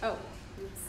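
ordinary talk, nothing marked

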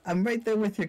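high, slow, squeaky character voice. Acted. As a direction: pitch it way up, thin and bright. Singer character praising the show.

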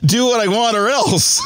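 high-pitched playful threat; hammed-up villain's demand; mock ultimatum.

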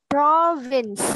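"Province" stressed on the first syllable. Shown as a demonstration. Teacher correcting a common class mistake, first-syllable stress. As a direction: punch the opening syllable of "Province". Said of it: "Âm tiết thứ nhất được nhấn".

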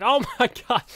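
high, loud half-laughed oh my god; startled laughing outburst; retold shocked gasp. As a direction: blurt it out in laughing disbelief. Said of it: "I was like".

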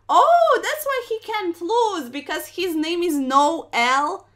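high, loud burst of realization; sudden realization. Real, not acted. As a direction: exclaim it as it dawns on you. Sudden realization about bot's name.

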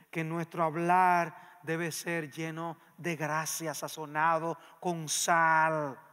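slow, deliberate, weighting each word; slow verse reading. Real, not acted. Preacher reading Colossians to congregation, slow and deliberate. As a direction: lay down the scripture slowly. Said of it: "reading verse emphatically".

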